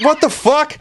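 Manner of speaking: high, startled outburst; shocked buddy. Retold or imitated. Imitating Ryan shocked, high and startled. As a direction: squeal it in shock. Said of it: "And Ryan's like".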